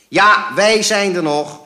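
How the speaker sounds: slow, high, loud shout. A narrator's reading. Father butting into mother's call, shouting. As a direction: bellow it across at the phone. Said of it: "schreeuwde mijn vader".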